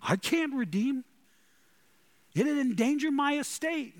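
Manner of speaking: high, put-on voice of a hesitant relative; reluctant kinsman. Preacher voicing the kinsman who refuses to redeem Ruth, high put-on voice.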